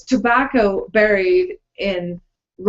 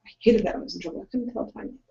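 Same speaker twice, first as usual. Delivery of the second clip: normal talking voice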